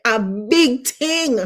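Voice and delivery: slow, drawn-out Jamaican accent; full-blown Jamaican. Shown as a demonstration. Host congratulates students in full Jamaican accent. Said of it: "my full-blown Jamaican".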